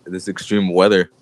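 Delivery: normal talking voice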